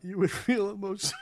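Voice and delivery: loud lines broken by laughter; can't keep a straight face reading the villain; laughing through it. Real, not acted. Reading a melodramatic villain line, giggling and loud.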